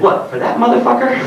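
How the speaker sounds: high mock sneer; mock rude clerk. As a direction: scoff like the bookstore guy. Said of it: "And the guy said".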